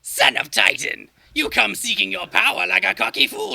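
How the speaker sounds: a lightly voiced ominous witch; mock Fates. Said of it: "your Mad Titan voice".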